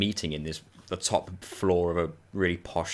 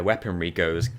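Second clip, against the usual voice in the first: usual speaking voice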